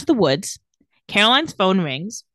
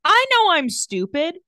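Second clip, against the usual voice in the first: higher, slow self-mocking voice; mock dumb Matt; 9 semitones higher, slower than usual. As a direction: play him dim and slow.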